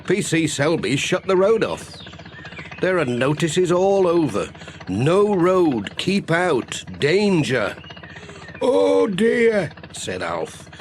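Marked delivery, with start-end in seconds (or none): 0.1-7.8 a somewhat raised, earnest postman; Pat. Acted. Postman warning the farmer about the road, lightly raised. 8.6-9.7 put-on high, dismayed old farmer; a worried countryman sigh of words, pitched high; Alf. Farmer hearing his road is shut, high and dismayed.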